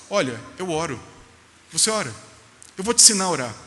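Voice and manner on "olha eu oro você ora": ordinary delivery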